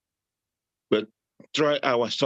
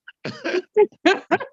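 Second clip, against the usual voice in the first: high laugh; 5 dB louder than usual. Real, not acted. Laughing at own joke.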